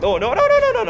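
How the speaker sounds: very high, frantic; panicked squeal. Real, not acted. Gamer about to lose the fight, panicked squeal. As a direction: squeal in alarm.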